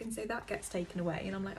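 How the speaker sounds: usual speaking voice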